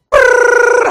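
high, loud lip-buzzed engine vroom; engine roar. Retold or imitated. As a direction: buzz your lips like a speeding car. Engine roar, 140 miles an hour.

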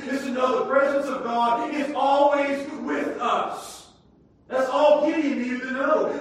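loud, high, sing-song pulpit cadence; climactic preaching. Real, not acted. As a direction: ring it out at the climax.

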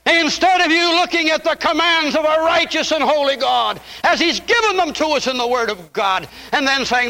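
high, urgent pulpit voice; full-force preaching. Acted. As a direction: press the point on the congregation.

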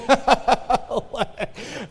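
an embarrassed laugh; sheepish laughter; awkward laugh. Real, not acted. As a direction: laugh off the discomfort. Laughs at awkward heaven talk.

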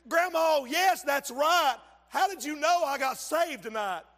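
high, loud excited question. Retold or imitated. Grandson telling grandma he got saved, excited. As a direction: tell grandma the big news. Excited retold phone call.

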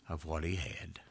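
hushed whisper to close the thought. Acted. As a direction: breathe it out in a whisper. Whispered phrase end.

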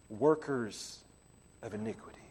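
whispered, low and soft; hushed verdict. Acted. Preacher repeating Jesus' judgment to congregation, whispered. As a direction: drop to a near whisper.

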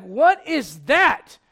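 slow, loud, pointed question; mom's sharp demand. Acted. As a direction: stretch each word, loud and unimpressed. Mom checks kid's chore.

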